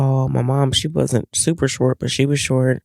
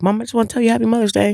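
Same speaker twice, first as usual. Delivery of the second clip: fast, higher rushed greeting; imitating son; 9 semitones higher, much faster than usual. Son coming downstairs at night, rushed and high. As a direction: blurt it out quickly and high.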